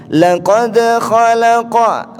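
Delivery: "Laqad khalaqa" lambat, d dan q memantul; qalqalah. Shown as a demonstration. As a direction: contohkan pantulan pada "Laqad". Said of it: "Huruf qalqalah ada huruf da". (baca pelan dengan qalqalah)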